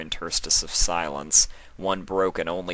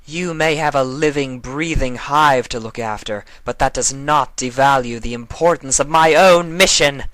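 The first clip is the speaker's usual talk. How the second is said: higher, louder snarl through bared teeth; 8 semitones higher, 9 dB louder than usual. Acted. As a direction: spit it through sharp teeth. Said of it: "Nightmare Moon snarled through her sharp teeth".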